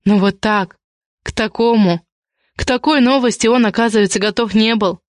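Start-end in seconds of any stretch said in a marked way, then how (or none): none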